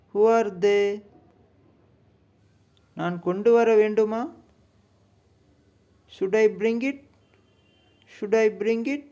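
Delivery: spoken English lesson read-aloud; clear lesson reading. Shown as a demonstration. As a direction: read the lesson lines out crisply for learners. Teacher reads lesson sentences aloud.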